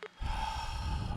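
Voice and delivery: long weary sigh; heavy sigh. Real, not acted. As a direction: sigh out disappointment. Dejected after muted meeting.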